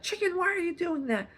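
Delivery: high, scolding squeal at the bird; hammed-up outrage at a pooping chicken; mock freak-out at a chicken. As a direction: overreact like the chicken wronged you. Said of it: "you're not gonna be like".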